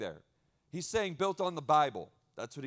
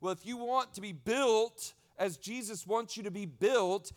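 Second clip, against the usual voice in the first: usual speaking voice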